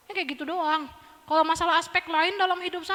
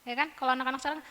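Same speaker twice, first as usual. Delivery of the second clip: ordinary talk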